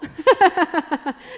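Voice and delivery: a quick bashful laugh; wife laughing about wanting to please her husband; short shy laugh. Real, not acted. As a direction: laugh it off, a little embarrassed.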